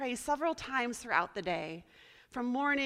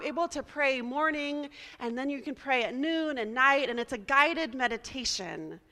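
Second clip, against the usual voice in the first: ordinary talk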